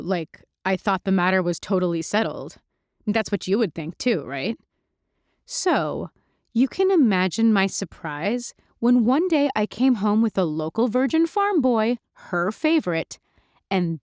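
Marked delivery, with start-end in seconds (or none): none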